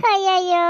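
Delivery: a sobbing ayyayyo, half wailed; crying wail. Acted. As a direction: wail it like an old woman in tears. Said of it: "ಅಳ್ತಾ ಇದ್ದೀರಾ". (ಅಳುತ್ತಾ ಅಯ್ಯಯ್ಯೋ ಎಂದು ನರಳುವುದು)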